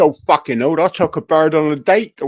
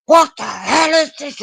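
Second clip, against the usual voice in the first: high, loud scandalised mum; shocked mum; 11 semitones higher, 4 dB louder than usual. Retold or imitated.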